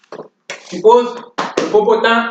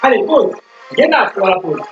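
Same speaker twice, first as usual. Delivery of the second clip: barking gym coach; 5 semitones higher than usual. Acted. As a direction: pump the class up like a fitness coach. Leads workout over music.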